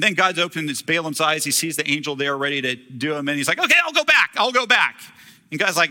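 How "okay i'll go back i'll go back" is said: scared backing down. Retold or imitated. Balaam sees the angel.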